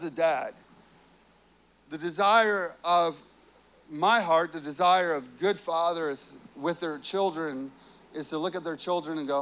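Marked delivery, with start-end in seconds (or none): none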